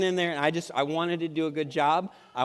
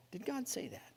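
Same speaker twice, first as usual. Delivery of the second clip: soft insinuation; 5 semitones higher, 15 dB quieter than usual. Acted. Serpent question, very soft.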